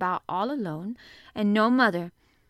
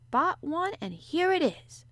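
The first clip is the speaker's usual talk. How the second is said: higher, rising to a drawn-out finish; triumphant; 9 semitones higher, 5 dB louder, slower than usual. A narrator's reading. Maid shows young mistress the skipping rope, triumphant. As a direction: build up to the big reveal. Martha triumphantly produces the gift.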